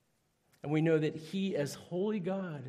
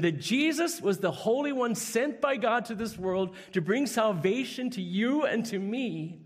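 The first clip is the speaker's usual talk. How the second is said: higher, louder preaching push; fervent preaching; 6 semitones higher, 5 dB louder than usual. Acted. Preacher urging the congregation to accept Jesus, fervent. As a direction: drive the call home to the congregation.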